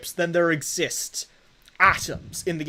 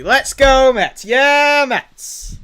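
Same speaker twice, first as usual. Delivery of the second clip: put-on loud, higher cheer in a rough Mets fan accent; attempted Mets fan accent; 7 semitones higher, 10 dB louder, much slower than usual. Player cheers a home run for the Mets, attempted fan accent. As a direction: belt out a badly faked Mets fan accent. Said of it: "I can't do the accent".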